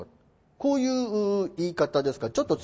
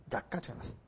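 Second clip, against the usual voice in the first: whispered aside; 15 dB quieter than usual. Real, not acted. Murmured repeat to self.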